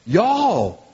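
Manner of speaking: stretched-out Southern y'all; Southern drawl. Acted. Preacher voicing Paul to his congregation, Southern drawl. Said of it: "Paul's speaking Southern".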